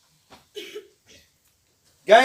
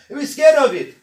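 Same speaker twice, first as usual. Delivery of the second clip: loud, higher frightened cry; mock fear; 5 semitones higher, 9 dB louder than usual. The heavens and mountains refusing the trust, loud mock fear. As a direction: play it scared. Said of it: "وَأَشْفَقْنَ مِنْهَا".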